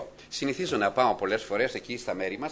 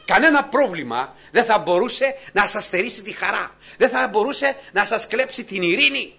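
high, loud exhortation; full-force preaching; 8 semitones higher, 8 dB louder than usual. Acted. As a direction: lift the whole congregation with it. (κήρυγμα με δύναμη, ψηλά και δυνατά)

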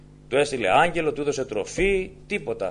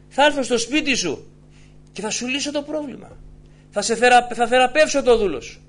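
loud, insistent sermon voice; fervent preaching; 8 semitones higher, 4 dB louder than usual. Acted. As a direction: drive the promise home to the faithful. Preaching at full force. (ένθερμο, δυνατό κήρυγμα)

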